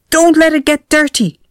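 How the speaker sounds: high, loud anxious yell; yelling boy. A narrator's reading. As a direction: shout across the pond at the caretaker. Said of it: "I yelled".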